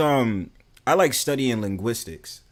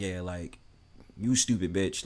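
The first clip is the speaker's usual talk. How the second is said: deadpan roast; 4 semitones lower, 6 dB quieter than usual. Retold or imitated. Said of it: "I'll be having a straight face".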